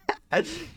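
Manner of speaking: squeaky burst of laughter; high laugh. Real, not acted. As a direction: giggle shooting up high. Laughs at donation voice.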